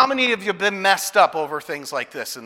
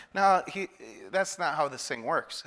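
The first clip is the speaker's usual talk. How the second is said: usual speaking voice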